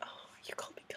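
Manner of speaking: tiny awestruck whisper; retold breathless fangirl swoon. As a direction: whisper it, overcome by the compliment. Swooning at the compliment.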